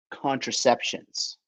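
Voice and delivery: ordinary delivery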